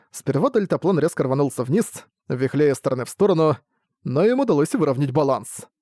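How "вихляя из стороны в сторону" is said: plain reading voice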